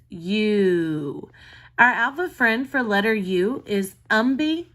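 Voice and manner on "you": "you" drawn out slowly as the long U; long U sound. Shown as a demonstration. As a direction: stretch "you" like the letter name U. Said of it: "which says".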